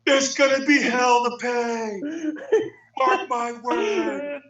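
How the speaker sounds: high, slow shouted threat cracking at the end; imitated furious threat. Furious driver vowing revenge, high slow mock shout. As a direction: bellow the warning like an outraged driver. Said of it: "And he goes".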